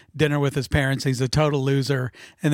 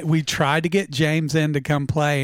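ordinary delivery

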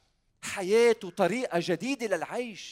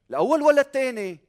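rousing question; 8 semitones higher, 10 dB louder than usual. Real, not acted. Preacher quizzes congregation loudly.

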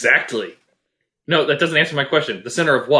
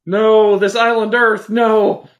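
loud, raised, drawn-out protest; loud pretend horror, pitched up; mock dismayed cry; 7 semitones higher, 5 dB louder, much slower than usual. Mock protest at what appears.

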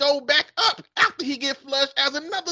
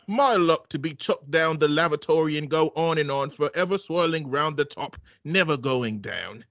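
put-on man's British accent; 9 semitones lower than usual. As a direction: read it like a smug English gentleman. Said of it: "man and a woman accent".

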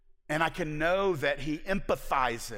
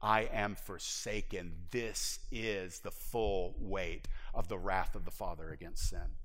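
low, somber; 9 semitones lower, 6 dB quieter than usual. Acted. Preacher lowers voice on Christ's agony.

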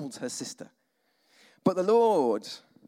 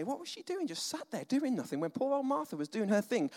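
indignant; 7 semitones higher, 5 dB quieter than usual. Retold or imitated. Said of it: "look at Mary and say".

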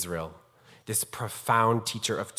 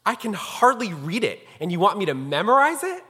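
high, loud retold protest; acting out a flabbergasted student; incredulous exclamation; 8 semitones higher, 6 dB louder than usual. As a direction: protest in disbelief, rising to the question. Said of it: "I exclaimed".

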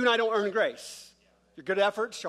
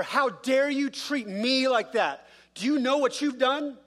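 higher, louder indignant outburst, half-screamed; angry screaming; 6 semitones higher, 5 dB louder, slower than usual. Acted. Vineyard workers going off on the owner, angry. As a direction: confront him, outraged, raised voice. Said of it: "the screaming is".

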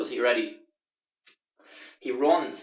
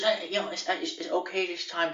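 higher, hesitant put-on voice; lightly voiced nervous landlord; 5 semitones higher than usual. Voicing landlord Justin.

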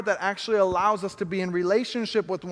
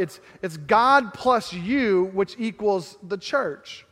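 slow, each term set apart; slow spelled-out equation; slower than usual. Shown as a demonstration. Pastor slowly spells out the equation to the church. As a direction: lay the equation out slowly for them. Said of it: "add to that equation now".

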